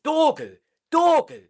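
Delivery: rising excited call of the name. Real, not acted. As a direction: hype the goalscorer. Celebrating a goal.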